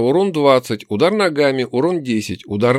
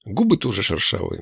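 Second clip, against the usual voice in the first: usual speaking voice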